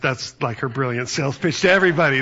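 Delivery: normal talking voice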